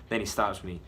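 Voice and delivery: ordinary delivery, nothing marked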